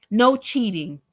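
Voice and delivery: ordinary talk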